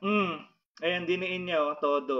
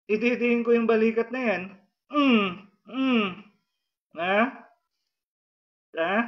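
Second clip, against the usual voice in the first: crying out; 4 semitones higher, 4 dB louder than usual. Real, not acted. Healer pressing shoulder, crying heard.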